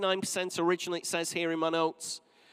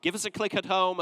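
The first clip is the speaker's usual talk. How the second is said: ordinary delivery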